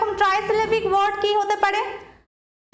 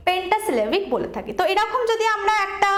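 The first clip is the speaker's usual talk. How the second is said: ordinary talk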